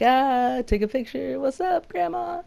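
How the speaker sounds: very high, drawn-out mock squeal; playful photo pose. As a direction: show off giddily for a snapshot. Acts out posing for a photo.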